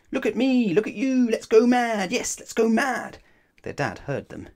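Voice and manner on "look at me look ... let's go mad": very high, loud excited shrieking; rowdy high-pitched kids' shouting; children yelling. A narrator's reading. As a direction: yell like children going wild. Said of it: "shouting, yelling, and screaming".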